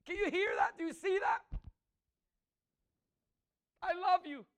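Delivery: loud, rising preacher's declamation; preaching at full force. Acted. Preacher proclaiming God's love to the congregation, full force.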